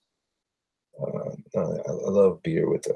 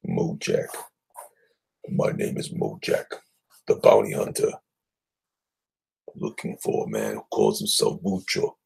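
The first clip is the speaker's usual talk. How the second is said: much lower, gruff-free deep bounty hunter intro; bounty hunter character announcing himself; 10 semitones lower than usual. Pretend. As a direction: introduce yourself like a movie tough guy.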